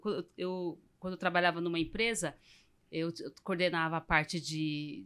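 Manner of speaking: ordinary delivery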